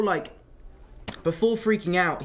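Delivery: normal talking voice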